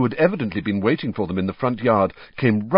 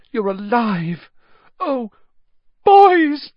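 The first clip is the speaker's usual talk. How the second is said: overjoyed mother; 8 dB louder, much slower than usual. Acted. Mother overjoyed at sons alive.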